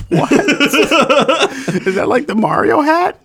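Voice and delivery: high, slow, through giggles; laughing. Real, not acted. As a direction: squeak it out mid-laugh.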